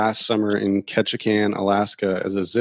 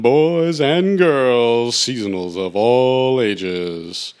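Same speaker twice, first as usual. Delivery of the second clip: slow, booming ringmaster announcement; grand entrance announcement; 5 dB louder, much slower than usual. Acted. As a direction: stretch each word out like a showman. Podcast host's showman opening.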